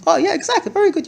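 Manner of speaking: father praises his daughter, delighted. Retold or imitated. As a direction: cheer the child on. Praising the child's answer.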